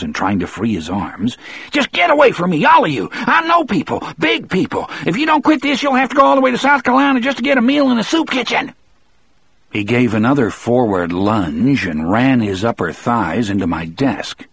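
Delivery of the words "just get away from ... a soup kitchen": very high, frantic tirade; yelled threats. A narrator's reading. Percy threatening the guards holding him, frantic high yelling. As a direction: rant in a strained high voice, rushing at the end. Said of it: "Percy yelled, jerking his shoulders".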